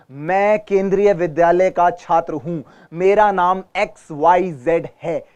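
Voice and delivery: slow, polished, each word of "केंद्रीय विद्यालय" distinct; clear slow reading. Shown as a demonstration. Teacher modelling polished slow speech for students. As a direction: polish every word slowly. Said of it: "चमा-चमा के बोलिए".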